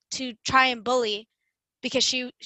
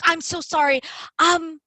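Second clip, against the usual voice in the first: higher, flustered apologetic imitation; imitated eager accommodating; 5 semitones higher, 3 dB louder than usual. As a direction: act out someone scrambling to please. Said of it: "running to, like, accommodate her".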